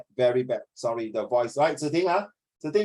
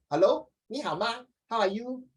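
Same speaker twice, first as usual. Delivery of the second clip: put-on Singaporean accent; 8 semitones higher than usual. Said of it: "Very Singlish".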